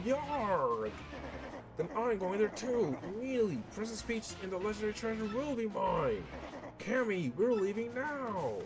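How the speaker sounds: a villain king bellowing orders; blustering villain king. Acted. Bowser shouting to his minions to leave at once.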